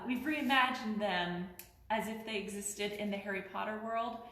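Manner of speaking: ordinary talk, nothing marked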